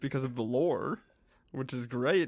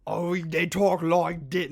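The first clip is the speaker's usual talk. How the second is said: slow, louder, botched Cockney attempt; horrible Cockney accent; 3 semitones higher, 6 dB louder, slower than usual. Shown as a demonstration. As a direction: put on a bad Cockney, drawn out. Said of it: "this horrible Cockney accent".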